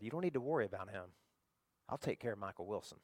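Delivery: low, soft big-brother assurance; softly retold protective promise; quiet reassurance. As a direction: reassure your little brother, low and understated. Brother reassures scared little brother.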